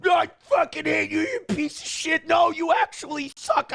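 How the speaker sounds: high-pitched angry mock rant; angry hater. Streamer mimicking an angry commenter, high-pitched rant. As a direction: put on a high, ranting hater voice. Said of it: "where they're just like".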